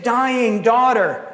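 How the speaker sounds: normal talking voice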